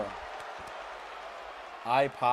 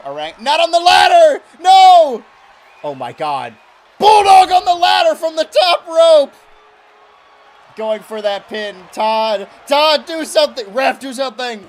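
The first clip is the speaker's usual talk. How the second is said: screaming commentator; 9 semitones higher, 14 dB louder than usual. Acted. Commentator screams at a ladder bulldog.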